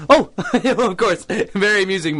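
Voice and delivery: slow, high, laughing "oh"; forced laugh. Acted. Reporter laughing off Miss Squirrel's jab, forced chuckle. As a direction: laugh it off with strained good humor.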